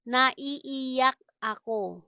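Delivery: stretched syllables, small gap between words; slow teaching model. Shown as a demonstration. Teacher models a crying phrase for learners, slowly. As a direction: sound it out carefully.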